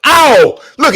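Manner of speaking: high, loud disgusted oh; disgusted yelp. Real, not acted. Streamer reacting loudly to a bad shot. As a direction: recoil loudly.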